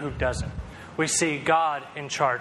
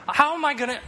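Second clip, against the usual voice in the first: much higher mock-kid yelp; frustrated little kid; 10 semitones higher than usual. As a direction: squeal like a kid whose fingers are trapped. Kid stuck in finger trap.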